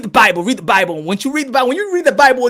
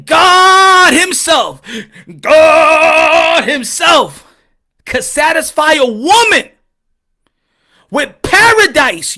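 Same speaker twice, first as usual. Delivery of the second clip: slow, drawn-out, louder preacher emphasis; preaching; 4 semitones higher, 6 dB louder, much slower than usual. Acted. Comic preacher driving home the point to the crowd, slow and loud. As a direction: preach it, lean on each word.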